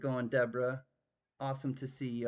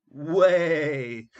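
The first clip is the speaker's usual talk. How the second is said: playful shout; 11 dB louder than usual. Pretend. Playful reply to no way.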